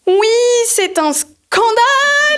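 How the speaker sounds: high, slow, loud mock scandal cry; hammed-up outrage. Yelling outside the shop to draw passers-by, hammed-up and loud. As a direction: overplay a public protest to draw a crowd. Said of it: "je me mets devant et je fais".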